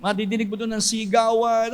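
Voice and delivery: normal talking voice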